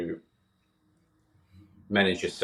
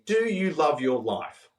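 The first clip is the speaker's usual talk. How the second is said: a raised, lightly voiced challenge to the interviewer; mild mock yell; 6 semitones higher than usual. Dying man challenging his interviewer, mild retold yell. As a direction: fire the question at him, half-shouted. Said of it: "he kinda yelled it at me".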